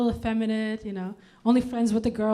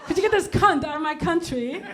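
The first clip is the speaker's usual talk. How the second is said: bad posh English accent; 6 semitones higher than usual. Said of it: "I told you I'm bad at accents".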